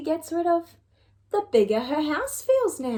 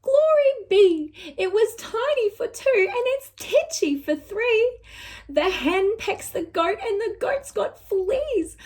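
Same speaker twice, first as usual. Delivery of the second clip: put-on a flustered old woman near tears; fretful old lady; 5 semitones higher than usual. Lady cries to the wise old man about her house. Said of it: "The little old lady cried".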